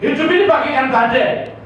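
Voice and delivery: high, loud pulpit proclamation; full-force preaching. Acted. A preacher proclaiming to the congregation, full-force preaching. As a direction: proclaim it to the whole hall.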